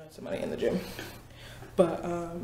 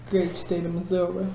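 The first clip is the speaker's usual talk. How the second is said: ordinary talk, nothing marked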